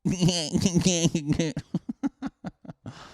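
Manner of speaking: long burst of high laughter. Real, not acted. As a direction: crack up at your own joke. Laughs at own bit.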